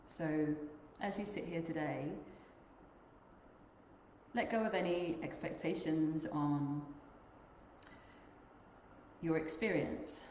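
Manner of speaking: unhurried meditation guide, long pauses between phrases; guided meditation. Acted. Yoga teacher guiding the group to notice their breath, guided meditation. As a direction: lead them gently inward, leaving long silences.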